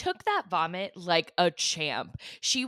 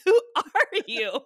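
slow, laughing through the question; laughing disbelief; 8 semitones higher, 3 dB louder, slower than usual. Real, not acted. Amazed at her friend, laughing it out. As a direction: laugh at how unbothered she is.

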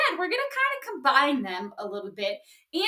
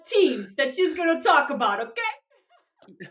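raised, hammed-up Italian-American wiseguy; Italian mafia accent; 7 semitones higher than usual. Host announcing teams to the co-host, Italian mafia accent. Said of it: "talking Italian mafia".

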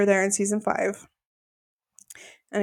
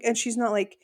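ordinary talk

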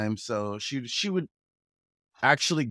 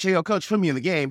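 eager player pleading; 7 semitones higher, 5 dB louder, faster than usual. Retold or imitated. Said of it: "whenever I'd ask the coach".

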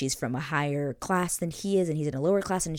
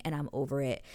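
ordinary delivery

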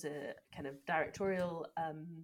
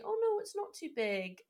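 mock reassurance; 10 semitones higher than usual. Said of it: "he doesn't say".

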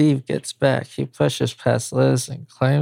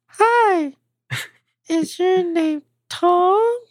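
put-on much higher girlish voice; young woman voice; slower than usual. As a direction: play a shy young girl. Man voicing young woman.

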